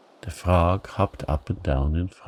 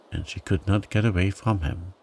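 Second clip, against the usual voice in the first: ordinary delivery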